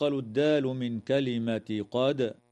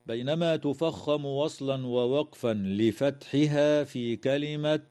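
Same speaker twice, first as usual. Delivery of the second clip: usual speaking voice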